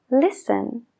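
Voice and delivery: "Listen" said lisen, no T sound; silent t. Shown as a demonstration. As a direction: drop the T in "Listen". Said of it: "no la oímos". ("Listen" sin la T, lisen)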